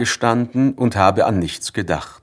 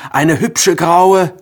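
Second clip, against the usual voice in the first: high, slow, louder admiring call; delighted call; 10 semitones higher, 5 dB louder, much slower than usual. Acted. Narrator admires the new word, high, slow and louder. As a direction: savour each word with delight. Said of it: "habe ich gerufen".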